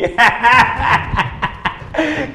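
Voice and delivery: high laugh breaking out; big laugh. Real, not acted. Host cracks up in the studio, laughing hard. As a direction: crack up helplessly.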